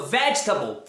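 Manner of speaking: "vegetable" stressed on the first syllable; light reduced ending on "vegetable"; correct pronunciation. Shown as a demonstration. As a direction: say "vegetable" as VEJ-tuh-bl. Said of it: "parabéns!".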